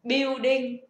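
"building" said with no L, like "biu-đinh"; common mispronunciation. Shown as a demonstration. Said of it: "các bạn sẽ đọc từ này là". (phát âm sai "building" thành "biu-đinh")

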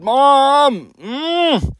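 high, loud call for Mom; tattling kid. Acted. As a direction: imitate a kid yelling for a parent. Child calling mom about siblings.

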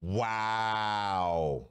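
ordinary talk

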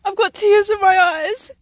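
high, loud, laughing through tears; crying with laughter. Real, not acted. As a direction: laugh until you cry.

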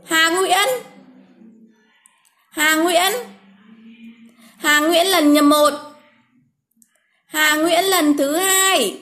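slow, drawn-out name call; slowly calling a winner's name. Real, not acted. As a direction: spell the name out slowly for viewers.

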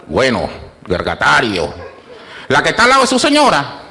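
usual speaking voice

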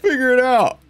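high-pitched mock scolding; mimicking game character. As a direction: imitate her in a high, bossy call. Repeats mimicked command.

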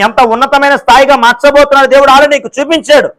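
preaching with mounting force, pitch climbing; rising sermon. Acted. Preacher to congregation about God's promises, rising fervent preaching.

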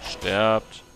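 loud, dramatic command; mock command. Gamer ordering enemies to die, loud mock command.

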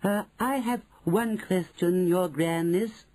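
high, slow, hesitant witch voice; witch in the audience. Acted. Witch questions Her Grandness, high and deferential. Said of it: "said a voice in the audience".

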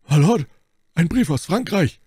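high, loud announcement; calling out. A narrator's reading. Patrick bursting in with a letter, high urgent call. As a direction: call the news across the hall. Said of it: "trat Patrick in den Saal und rief".

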